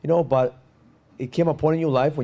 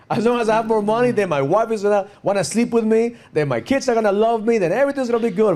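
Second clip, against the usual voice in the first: much higher, louder mock optimism; hopeful men's reasoning; 10 semitones higher, 5 dB louder than usual. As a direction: voice a man convincing himself, pitched up and punched out. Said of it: "most men think that what we need".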